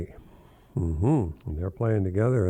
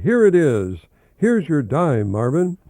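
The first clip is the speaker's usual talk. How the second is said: louder, higher, triumphant; hearty father; 6 semitones higher, 7 dB louder, slower than usual. Acted. Father holds out the dime to Marvin, loud and triumphant. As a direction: announce it like a proud dad.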